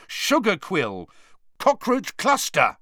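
loud, high, desperate shouting; angry yell. A narrator's reading. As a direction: keep yelling passwords. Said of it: "he yelled angrily".

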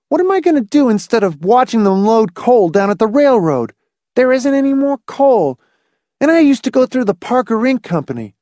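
put-on high-pitched boy's litany of grievances; resentful boy. A narrator's reading. Boy protesting everything abolished, high and resentful. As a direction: pile up complaints like an indignant boy.

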